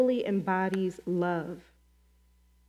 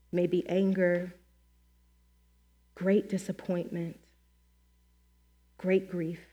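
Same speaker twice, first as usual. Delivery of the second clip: prayer. Acted. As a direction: pray gently, letting each phrase settle. Leads the congregation in prayer.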